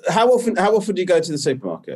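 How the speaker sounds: high, disbelieving probing question; incredulous. Real, not acted. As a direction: ask it in disbelief.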